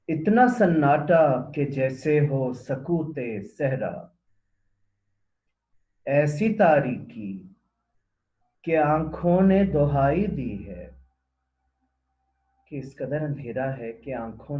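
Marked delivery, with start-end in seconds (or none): 0.1-11.0 slow, loud, measured verse delivery; poem recitation. Acted. Presenter reciting Ahmed Faraz's poem to listeners, poem recitation. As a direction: declaim the couplet with drawn-out words.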